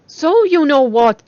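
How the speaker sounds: loud, slow, high excited cry; bursting to hear the news. A narrator's reading. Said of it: "Jessica cried".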